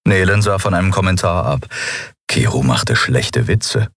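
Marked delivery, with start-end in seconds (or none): none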